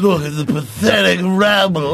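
slow, sneering lordly character voice; Professor Anselmo. Acted. Lord riding along looking at the peons, haughty disdain. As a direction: look down on the peons, drawn out. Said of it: "some guy named Professor Anselmo".

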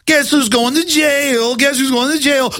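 smug cop teasing a protester; mock gloating cop. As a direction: taunt like a cartoon villain.

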